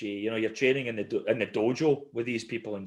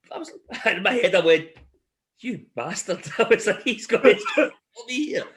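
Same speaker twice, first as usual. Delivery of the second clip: telling it through laughter, pitched up; laughing; 7 semitones higher, 4 dB louder than usual. Real, not acted. Recalling his panic at being attacked in a grading, laughing. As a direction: laugh through the words, voice climbing higher.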